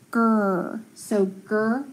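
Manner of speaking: growled "grr" for the G R blend; phonics sound. Shown as a demonstration. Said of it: "that G R says".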